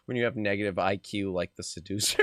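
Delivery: ordinary delivery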